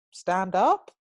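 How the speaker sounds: rising, unsure instruction; hesitant order lifting into a query; tentative command. Retold or imitated. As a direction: venture it as a question, swooping up at the end. Tentative command to healed man.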